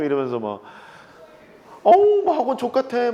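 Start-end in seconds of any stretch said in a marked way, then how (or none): 1.8-3.1 high, loud mock whine of a teen student; hammed-up teenage complaint; whiny student. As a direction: imitate a carefree kid griping about cram school. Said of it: "막 이러면서".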